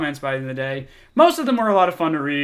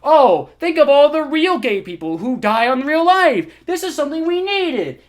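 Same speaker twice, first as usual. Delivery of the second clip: higher, louder sneering accusation; mocking commenter; 8 semitones higher, 6 dB louder than usual. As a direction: ham up the self-righteous commenter. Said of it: "And he goes".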